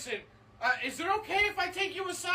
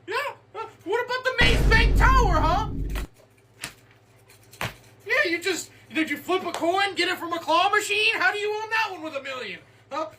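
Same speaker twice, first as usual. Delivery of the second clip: interrogating tax auditor. Acted. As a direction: grill him like a suspicious tax man. Tax auditor grills the rich player.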